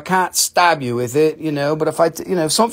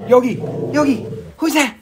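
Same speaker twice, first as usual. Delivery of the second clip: high-pitched coaxing pet talk; calling a dog; 8 semitones higher, faster than usual. Real, not acted. Owner calls the dog to the camera, high pet talk.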